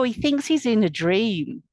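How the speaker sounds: ordinary delivery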